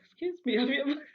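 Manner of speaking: high, loud and laughing in disbelief; a shocked cry breaking into laughter; indignant outburst. Retold or imitated. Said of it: "I was like".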